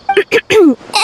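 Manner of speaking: brisk ahem before changing topic; short businesslike ahem; throat clear. Acted. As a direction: clear the throat to get back on track. Returns to the point.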